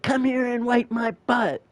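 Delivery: put-on high bratty kid yell; kid yelling at mom. Five-year-old yelling at his mom, high bratty kid voice. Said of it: "Yelling at mom".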